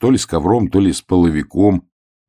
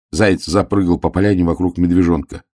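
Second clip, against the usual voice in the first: ordinary delivery, nothing marked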